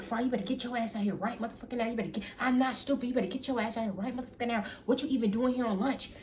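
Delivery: rapid-fire retold telling-off from a dad; imitated angry father. Angry father orders son out.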